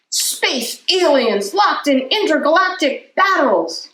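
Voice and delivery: a touch of movie-trailer voice; dramatic. Acted. Reader dramatizing Brian's drawings, lightly voiced. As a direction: announce it with drama.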